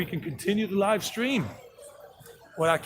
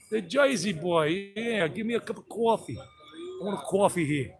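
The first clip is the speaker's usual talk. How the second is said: softer, put-on New Jersey accent; 2 semitones higher, 5 dB quieter than usual. Jersey boy ordering a cup of coffee, Jersey accent. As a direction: say "Jersey" as "Joisey", "coffee" as "cawfee". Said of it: "I got a different one when I'm talking to my brother".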